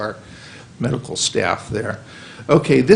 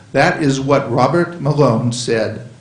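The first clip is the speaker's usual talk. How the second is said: ordinary talk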